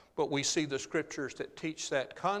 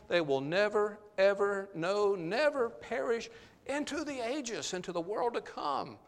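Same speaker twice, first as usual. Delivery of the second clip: NEVER and EVER set apart, pitched higher for emphasis; emphatic; 5 semitones higher than usual. Shown as a demonstration. Preacher assuring believers they will never perish, emphatic on NEVER and EVER. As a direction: stress NEVER and EVER, drawing out "no, never perish" high. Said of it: "it was that way for emphasis".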